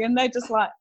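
ordinary talk

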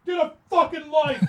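high mocking yell at them; yelling guy. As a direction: shout it like a passing jerk. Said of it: "this guy just goes".

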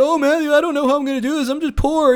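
mocking whine of someone begging for help; mock whiner. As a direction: mimic a self-pitying whine, hammed-up. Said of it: "start whining and complaining".

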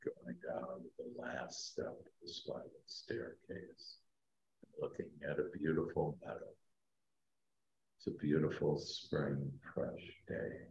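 slow, soft meditation voice; sleep story narrator. Acted. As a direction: guide them down gently, fading to a whisper. Leads a guided meditation.

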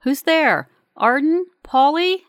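searching call, names lifted in question. A narrator's reading. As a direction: call into the smoke for missing friends. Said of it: "lift my mask and call out".

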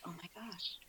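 very soft, whispered dismay; near-whispered reaction to grave news; retold hushed shock. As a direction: let it out under the breath, stunned. Retelling reaction to brother's death.

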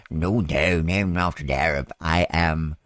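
low, lightly put-on English accent; mock English accent. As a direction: imitate an English-accented speaker, pitched low. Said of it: "with an English accent".

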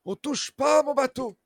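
high, loud and territorial; possessive shout. Acted. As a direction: bark it out to protect the boat. GM voices boatman, loud.

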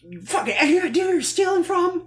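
high, loud hyped-up yell; excited shout. Acted. Bank manager eager to shoot robber, excited shout. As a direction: act thrilled and furious, hollering it. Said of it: "he's so excited to shoot this guy".

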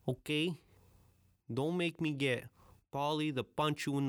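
a hint of a New York Italian wise guy threatening; Italian mobster accent. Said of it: "that's okay to use that accent".

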